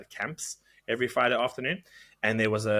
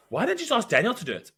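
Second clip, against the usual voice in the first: indignant teacher; 10 semitones higher, 4 dB louder, faster than usual. Retold or imitated. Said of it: "the one teacher's like".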